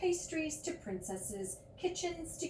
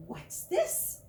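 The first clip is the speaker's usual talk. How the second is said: surprised; 6 dB louder than usual. Acted. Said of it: "asked William".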